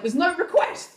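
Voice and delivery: high-pitched mock yell; calling back. Wife answers husband from the house, high yell.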